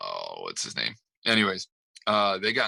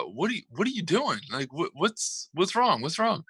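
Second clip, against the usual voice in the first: high-pitched, pressing mock questions; acted bewilderment; 8 semitones higher than usual. Retelling one coach questioning the other, high mock bewilderment. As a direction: imitate a puzzled coach demanding answers.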